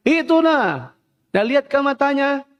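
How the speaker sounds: high-pitched mock holler; child yelling back. Little sibling answers the older one, imitating yelling. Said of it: "Ditiru juga anaknya".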